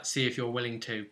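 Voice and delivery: usual speaking voice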